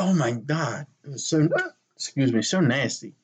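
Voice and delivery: talking through hiccups; hiccuping. Real, not acted. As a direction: hiccups interrupting the words. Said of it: "You sound like a fucking baby with the hiccups".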